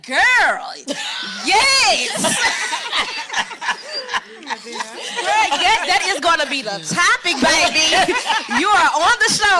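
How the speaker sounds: loud, high, laughing whoop; excited mother. Retold or imitated. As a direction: cheer her on, shrieking with laughter. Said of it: "she said".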